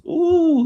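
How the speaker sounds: high ooh. Real, not acted. Reacting to God's sarcasm.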